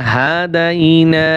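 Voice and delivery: হাদাইনা stretched out very slowly; slow drawn recitation. Shown as a demonstration. Teacher slowing down the word for students, drawn out. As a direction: draw each syllable of হাদাইনা long. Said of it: "এক আলিফ টান হবে".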